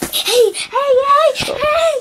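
squealing shouts while tickled; being tickled. Real, not acted. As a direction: shriek through the tickling. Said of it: "Daddy, Dad's tickling me".

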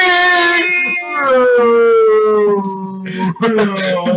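laughing shout. Real, not acted.

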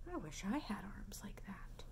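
wistful hushed whisper; soft whispered longing. Acted. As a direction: wish it under your breath.

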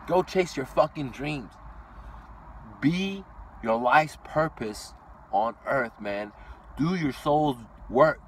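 slow, weighty motivational preaching; drawn-out inspirational speech; slow solemn urging. Acted. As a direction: lay each phrase down slowly, like a sermon.

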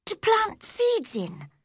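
put-on high girl's voice, pushing on; going on bravely. A narrator's reading. Girl explaining her wish to her guardian, going on bravely. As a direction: press on with the request, pitched well up. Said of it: "Mary went on bravely".

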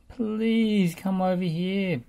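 high, loud, drawn-out plea; beg, stretching the words out; begging. A narrator's reading. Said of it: "begged Travis".

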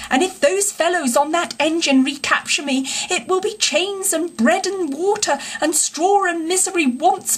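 high-pitched theatrical supplication; pleading cry. A narrator's reading. Toad begging the engine driver for rescue, pleading. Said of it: "raising his clasped paws in supplication cried".

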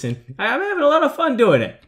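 ordinary delivery

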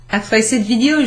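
usual speaking voice

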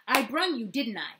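put-on loud, eager little boy asking; a child who wants to be told he did well; eager child demanding. A narrator's reading. Matt pressing the blind man, loud eager child. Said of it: "demanded confirmation".

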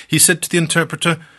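ordinary delivery, nothing marked

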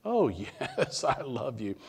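high, laughing eager answer; man clinging to a branch, laughing eagerly; laughing yes. Retold or imitated.

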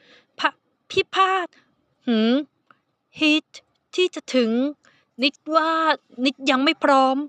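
high, slow, halting bashful voice; flustered shy youngster. Acted. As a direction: stumble out the confession. Nid embarrassed admits not ready.

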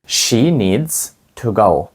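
slow, word by word; slow teacher demo; slow model sentence. Shown as a demonstration. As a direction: say it slowly for the class to copy. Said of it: "Скажіть, їй потрібно йти".

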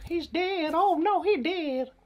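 very high, squeaky cartoon voice; squeaky mock voice. Gamer mocking a knocked-out guard in a squeaky voice. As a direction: squeal it like a silly cartoon character.

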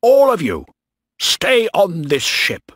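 high, loud, desperate command; shouted order. A narrator's reading. Captain ordering sailors to stay aboard, shouting. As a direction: shout it out to all the crew. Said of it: "Bligh shouted".

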